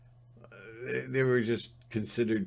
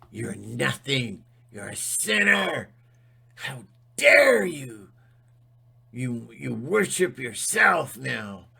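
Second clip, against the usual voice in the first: mock scolding preacher. Mocking a church accusing a sinner, wailing. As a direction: ham up a preacher crying shame.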